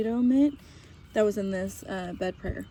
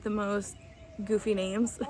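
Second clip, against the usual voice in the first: ordinary talk, nothing marked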